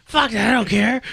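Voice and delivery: put-on loud, high drunken shouting; drunk yelling. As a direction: yell it like a drunk bro who doesn't care. Retold drunk defiant outburst.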